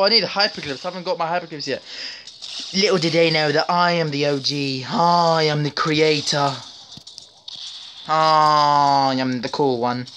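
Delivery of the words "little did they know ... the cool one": slow, drawn-out grand announcer voice; slow self-important proclamation; mock epic boast. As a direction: boast like a movie trailer. Dramatic boasting narration.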